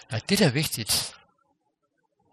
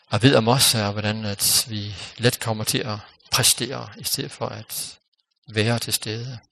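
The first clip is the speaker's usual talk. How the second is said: closing prayer. Real, not acted.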